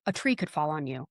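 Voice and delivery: ordinary talk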